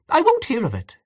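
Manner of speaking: a high exclamation of refusal; cried out. A narrator's reading. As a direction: fling the refusal out, pitched high. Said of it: "she cried".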